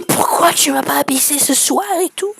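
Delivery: gasped, mock-offended woman's voice; gasp, then play her hurt complaint; put-on whiny reproach; displeased woman, imitated. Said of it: "Elle était pas contente".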